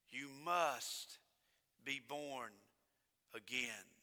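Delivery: low, soft, slow words of Jesus; hushed solemn quote. Acted. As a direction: drop almost to a whisper.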